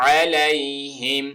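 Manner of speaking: علیہم with a throaty ع; clear ain. Shown as a demonstration. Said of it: "وہ عین آ گیا".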